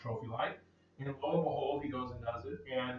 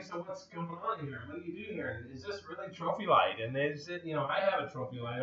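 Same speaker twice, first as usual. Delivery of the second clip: weaselly voice; 2 semitones higher, 8 dB quieter than usual. Retold or imitated. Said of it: "so he's like".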